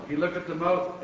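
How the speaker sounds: ordinary delivery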